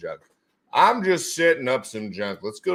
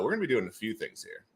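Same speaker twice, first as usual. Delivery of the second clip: ordinary talk, nothing marked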